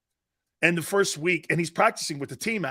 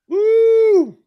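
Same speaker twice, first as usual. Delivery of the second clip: loud, high whoop; cheering whoop; 12 dB louder than usual. Real, not acted. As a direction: let out a big joyful woo. Cheering at a heel joke.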